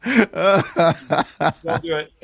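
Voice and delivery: laughing plea. Retold or imitated. Behind his buddy at the airport counter, begging him not to say it: slow laughing plea. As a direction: drag it out through laughter, begging him to stop. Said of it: "And I'm behind him going".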